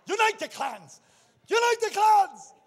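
high, very loud movie-hero shouting, building to a scream; battle cry. Acted. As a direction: yell it across a battlefield, stretching the words. Acts out Braveheart battle cry.

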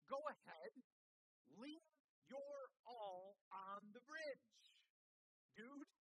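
retold shout. Woman to pastor from her back porch, retold shout. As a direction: call out loud, each word drawn out. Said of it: "She shouted from her back porch".

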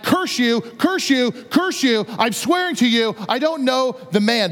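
mildly hammed-up, insistent denial; animated cursing. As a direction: act out swearing while backing away. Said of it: "it's getting a little bit more animated".

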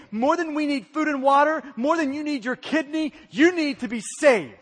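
ordinary talk

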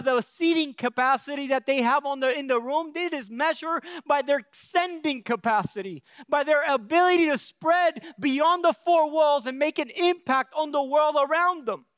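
loud, rising preacher's cry; preaching at full force. Acted. Preaching climax, loud and high.

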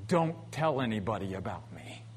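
soft secretive whisper. Acted. Quoting God, hushed aside.

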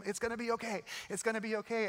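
acted comfort under pressure; urgent reassurance. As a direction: reassure over someone's screaming. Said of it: "She starts screaming".

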